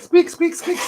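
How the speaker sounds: high squeaky squeaks; rubbery high squeaky toy. Pretend. Echoing squig noises.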